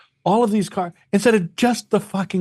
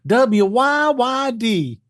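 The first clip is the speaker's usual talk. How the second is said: mock local radio twang; 6 semitones higher, much slower than usual. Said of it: "Listen how they talk".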